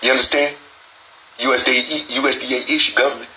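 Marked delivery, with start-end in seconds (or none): none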